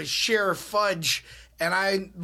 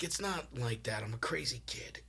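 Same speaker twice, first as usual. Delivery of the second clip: whispered, softer and lower, embarrassed; sheepish whisper; 6 semitones lower, 9 dB quieter than usual. Retold or imitated. Telling the nurse with the AA pamphlet, a soft whisper. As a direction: mutter the excuse under your breath.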